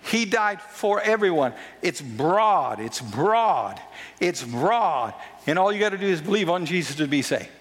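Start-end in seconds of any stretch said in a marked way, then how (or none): 1.8-5.1 a low, stretched "broad" each time; drawn out broad. Real, not acted. Preacher stretching "broad" low and wide. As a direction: lean low and slow on "broad" to show the wide top.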